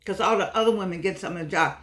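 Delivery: ordinary delivery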